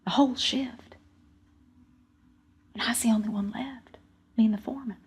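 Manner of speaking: soft, sombre, near-whispered narration; subdued, stunned telling; hushed storytelling. A narrator's reading. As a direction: let the loss sink in quietly. Telling who survived the collapse.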